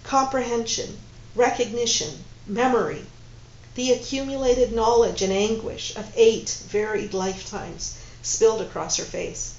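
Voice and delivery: ordinary delivery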